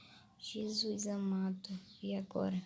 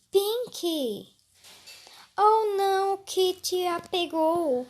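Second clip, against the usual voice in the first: startled shout; 8 semitones higher, 12 dB louder, slower than usual. Real, not acted. Character caught in horror game.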